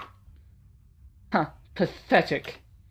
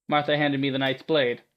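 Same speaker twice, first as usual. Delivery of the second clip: ordinary delivery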